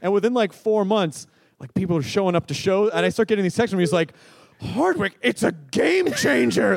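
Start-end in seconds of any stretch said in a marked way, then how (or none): 4.6-6.8 higher, drawn-out hype quote; mock-excited announcement; excited imitation. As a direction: imitate a friend hyping it up, stretching the words. Retelling Pete's excited texts.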